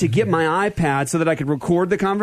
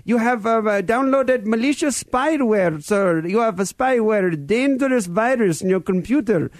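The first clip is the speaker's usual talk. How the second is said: put-on high-pitched Indian-accented scam caller; earnest Indian call-centre impersonation, higher voice; 7 semitones higher, slower than usual. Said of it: "with a strange Indian accent".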